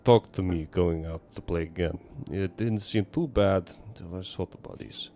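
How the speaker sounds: worried father with a bad Russian accent. Neighbor fears for daughter, bad Russian accent. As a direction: confide in an amateur Russian accent. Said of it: "bad Russian accent right now for your neighbor".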